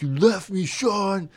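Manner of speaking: high, pleading jilted buddy voice; whiny best friend. Acted. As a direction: play the abandoned wrestling partner. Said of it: "a B F F quarrel".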